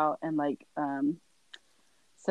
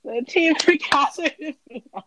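laughing through the words, pitched up; 9 semitones higher, 4 dB louder than usual. Real, not acted. Repeating the team name to friends, laughing and pitched up. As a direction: giggle it out high. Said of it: "laughs within repeat".

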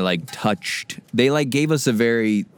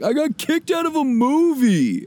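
put-on whiny kid. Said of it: "I was like".

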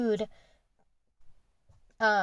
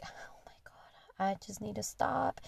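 whispered to herself; 6 dB quieter than usual. Retold or imitated. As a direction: mutter it under your breath, exasperated. Exasperated aside to herself.

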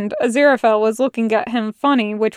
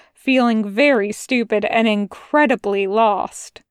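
plain reading voice

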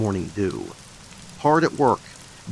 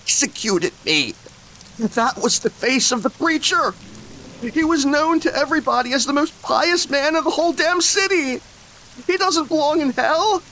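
frantic shouting. Acted. Derek shouting at Josiah that this isn't hell, frantic high yell. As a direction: pour it out, near hysterical.

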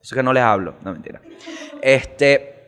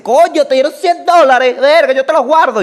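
high, loud put-on voice grabbing the money; mock grabby voice; 11 semitones higher, 9 dB louder than usual. Mocking someone who pockets the kid's cash, high and loud. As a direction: squeal it like a greedy moocher. Voicing a person from the clip.

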